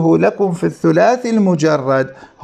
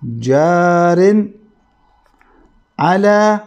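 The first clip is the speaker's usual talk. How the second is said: slow stressed word; much slower than usual. Shown as a demonstration. Teacher to students, spelling out the rule, slowly. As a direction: linger on جار to fix the term. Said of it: "اسم الفاعل".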